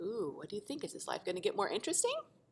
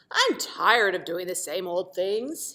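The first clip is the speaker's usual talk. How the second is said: slow, louder grumble of a bored triangle; grumbling; 11 dB louder than usual. Acted. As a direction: complain like you're fed up with routine. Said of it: "he grumbled".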